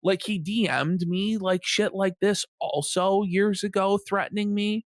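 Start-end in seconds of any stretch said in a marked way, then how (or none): none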